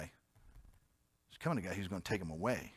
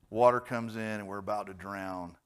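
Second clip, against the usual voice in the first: ordinary delivery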